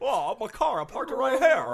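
put-on high stammering old-movie voice; high, frantic Jimmy Stewart whine; Jimmy Stewart impression. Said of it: "Jimmy Stewart".

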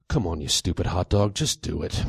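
low, weary exasperation; softening, low, resigned scolding; resigned indignance. A narrator's reading. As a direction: drop your voice, fed up with it all. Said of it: "with resigned indignance".

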